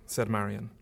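plain reading voice

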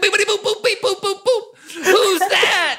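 high squeaky robot beeps; robot beeping voice. Pretend. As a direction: beep like a startled little robot.

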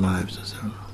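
hushed, breathy repeat of the complaint; whispered repeat. Retold or imitated. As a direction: drop to a whisper on the second "my life is so terrible".

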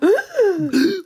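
high, drawn-out theatrical gasp; mock-amazed gasp. Reacting to the Weird Wave announcement, mock-amazed gasp. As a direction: gasp in exaggerated wonder.

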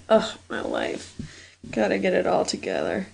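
a weary, defeated aside; dramatic sigh. Real, not acted. Frustrated muttering to herself.

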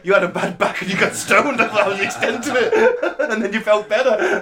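high-pitched, laughing through the words; laughing teasing. Real, not acted. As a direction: tease a mate while cracking up. Laughing while repeating the jibe.

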